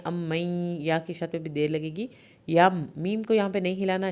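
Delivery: ordinary talk, nothing marked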